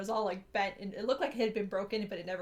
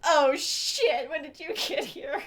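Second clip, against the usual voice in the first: higher, loud startled gasp of words; caught off guard; 5 semitones higher, 8 dB louder, much slower than usual. Retold or imitated. Student caught by the principal, startled and loud. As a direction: sound caught red-handed, slowing down. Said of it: "And I was just like".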